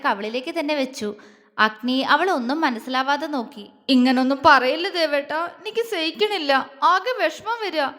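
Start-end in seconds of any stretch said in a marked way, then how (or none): 3.9-7.9 put-on high, tearful little-girl plaint; childlike whimpering. A narrator's reading. Upset wife pleads with her husband, whimpering. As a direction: plead like a small child about to cry. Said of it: "കൊച്ചുകുട്ടികളെ പോലെ വിതുമ്പിക്കൊണ്ട് പറയുന്നത്".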